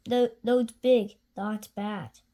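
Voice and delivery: ordinary delivery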